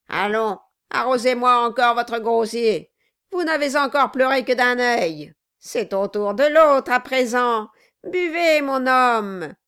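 boisterous order, peaking at the end; crying out. A narrator's reading. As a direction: goad him to drink, building to a shout. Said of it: "cria le nain".

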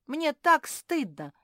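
high, loud, emphatic confession; impassioned cry of shame; exclaimed shame. Acted. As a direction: burst out with embarrassment.

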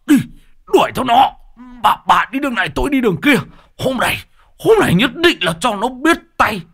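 high, loud angry commands; furious orders. Acted. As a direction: bark orders at the wife in a rage. Lão Hói tức quá ra lệnh. (ra lệnh giận dữ)

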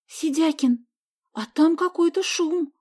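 put-on worried girl hearing a noise; встревоженно. A narrator's reading. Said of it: "вдруг встревоженно сказала Соня". (встревоженно, повыше и медленнее)

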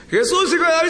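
high, insistent pleading yell; shouting harder. Acted. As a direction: cry out over those hushing you. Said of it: "empezó a gritar más fuerte".

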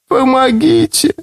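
high raspy voice from the dark; hoarse faint cry for help; raspy plea. A narrator's reading. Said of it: "Голос был тихий и хриплый".

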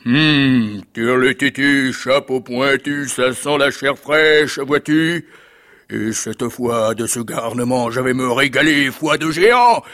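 huge, drawn-out ogre voice; thundering ogre. Acted. The giant sniffs out fresh flesh, thundering ogre voice. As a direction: boom slowly, swelling to a loud finish. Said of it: "l'énorme voix de l'ogre tonna".

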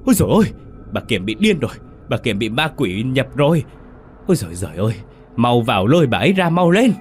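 panicked villagers crying out, pitch leaping up; horrified shrieking. A narrator's reading. Villagers seeing the widow possessed, horrified cries. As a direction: raise the alarm loudly, in shock. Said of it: "thì rú lên kinh hãi".

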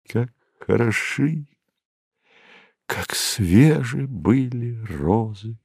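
soft, lingering, lilting recitation; tender refrain. A narrator's reading. Dreamer remembers the beloved girl, tenderly lingering on the line. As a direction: draw the words out softly. Refrain recalled as his heart beats.

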